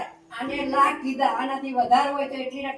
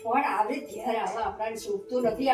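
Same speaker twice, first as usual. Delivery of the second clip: quiet disappointment; 5 semitones lower, 7 dB quieter, slower than usual. Acted. Mother disappointed, hurt pride.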